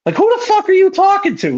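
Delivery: high-pitched indignant comeback, acted out; getting mad. Fan answering the cursing wrestler, high and mad. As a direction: replay the indignation, pitch leaping up. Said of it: "I was getting mad".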